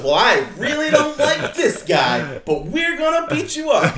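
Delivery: high, slow, loud mock promo voice; mocking promo reply. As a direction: ham up the stiff promo line. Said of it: "And then she goes".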